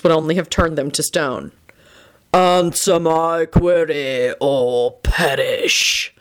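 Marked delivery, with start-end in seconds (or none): 2.3-6.1 very slow, drawn-out sphinx proclamation; dramatic sphinx. A narrator's reading. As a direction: stretch every word into a dramatic threat. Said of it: "the Sphinx declared dramatically".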